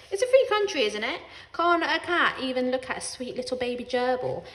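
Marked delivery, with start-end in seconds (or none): 0.1-1.2 indignant. A narrator's reading. Defensive cat.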